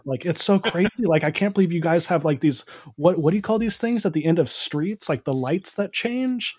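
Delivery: high, amazed fake-American gush; teasing friends, faking astonishment as a clueless American visitor; mock wide-eyed tourist. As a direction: play a gullible foreigner marveling at traffic lights. Mocking a naive American tourist.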